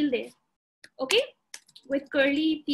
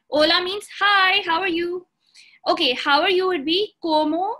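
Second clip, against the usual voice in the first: ordinary talk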